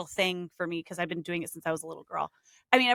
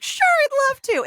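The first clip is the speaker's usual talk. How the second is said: light up at the request, higher and louder; eager delight; 8 dB louder than usual. Retold or imitated. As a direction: sing it out high, slowly and loud. Eager reply to a client.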